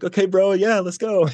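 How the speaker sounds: high-pitched cheering along; enthusiastic friend, imitated. Friend replying to his clean-days news, excited.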